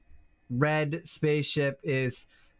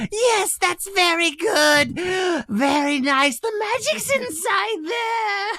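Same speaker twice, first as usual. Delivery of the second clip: very high, louder sugary praise; creepy Santa; 14 semitones higher, 7 dB louder than usual. Acted. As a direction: praise her like a sinister Santa. Santa praising the trapped girl.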